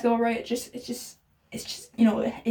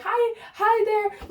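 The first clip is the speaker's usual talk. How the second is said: mock greeting; 9 semitones higher, much slower than usual. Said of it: "do I say, like".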